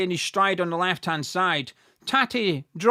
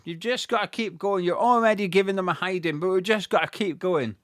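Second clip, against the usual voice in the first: normal talking voice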